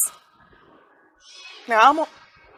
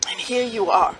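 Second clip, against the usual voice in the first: normal talking voice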